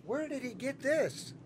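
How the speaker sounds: usual speaking voice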